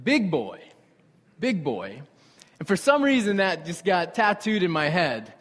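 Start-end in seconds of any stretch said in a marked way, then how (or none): 0.0-2.0 lightly imitated English accent; a touch of mock British. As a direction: mimic Gordon Ramsay's English accent on a nickname. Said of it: "over and over in his accent".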